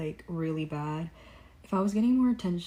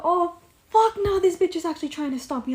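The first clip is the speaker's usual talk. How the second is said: much higher, loud mock outburst; shocked outburst; 11 semitones higher, 8 dB louder than usual. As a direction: blurt the retold shock at full volume. Retold shocked inner reaction.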